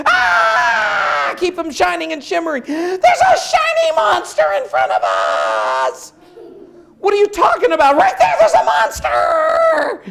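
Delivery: high, loud, slow panicked shout; frightened horse cry. Acted. Horse shrieks at a shimmering bush, high panicked yelling. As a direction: scream at the shiny monster.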